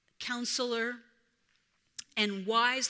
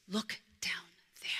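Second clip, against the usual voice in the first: soft, voiceless hush; mysterious whisper to the cub; 3 semitones lower, 9 dB quieter than usual. Acted. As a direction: draw him in with a hush. Rafiki pointing to pool.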